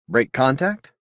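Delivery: plain reading voice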